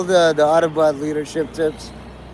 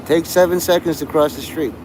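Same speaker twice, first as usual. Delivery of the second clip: normal talking voice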